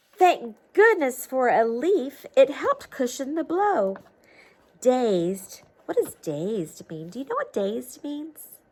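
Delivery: usual speaking voice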